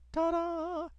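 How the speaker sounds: high sing-song ta-da, like a magician's reveal; mock ta-da. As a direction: announce it as a cheesy little fanfare. Mock fanfare before punchline.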